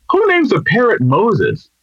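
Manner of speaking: high, slow incredulous question; mock bafflement. Burglar questions a parrot about its name, comically incredulous. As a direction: draw it out high in comic disbelief.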